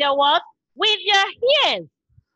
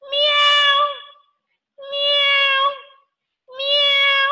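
high, drawn-out meow; high-pitched pretend cat; 9 semitones higher, much slower than usual. Shown as a demonstration. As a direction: imitate a cat with high held cries. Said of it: "That's the sound of a cat".

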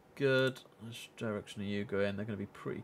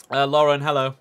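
much louder hail to someone; real loud call, a bit higher; 4 semitones higher, 15 dB louder than usual. Real, not acted. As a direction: call across the room to get her attention. Calling someone in the room.

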